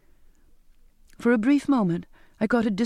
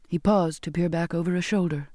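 ordinary delivery, nothing marked

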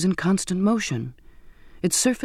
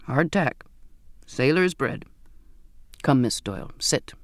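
put-on old cook Zachariah; 4 semitones lower than usual. A narrator's reading. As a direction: greet her warmly as the old ship's cook. Old sailor Zachariah voiced.

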